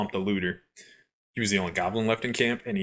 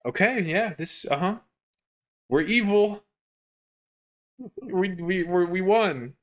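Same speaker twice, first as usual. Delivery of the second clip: awkward forced cheer; 10 semitones higher than usual. Retold or imitated. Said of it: "You're like".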